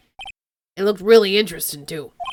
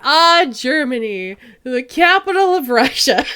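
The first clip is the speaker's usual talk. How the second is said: slow, laughing pronouncement; deadpan joke dissolving into laughter; mock-solemn joke; 3 semitones higher, 4 dB louder, slower than usual. Real, not acted.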